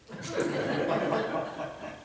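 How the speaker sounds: breathy high laugh at the memory; laughing at his own story; real laugh. Real, not acted. Preacher laughing at his carpenter memory, real laugh.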